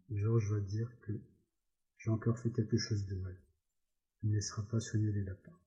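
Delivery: ordinary delivery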